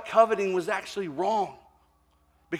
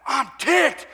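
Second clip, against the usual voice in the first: higher, louder angry outburst; angry retort; 8 semitones higher, 7 dB louder than usual. Retold or imitated. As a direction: snap it back, fed up. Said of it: "Brad's like".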